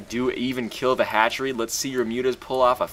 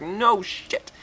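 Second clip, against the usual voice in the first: mock realization; 12 semitones higher than usual. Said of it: "I'm like".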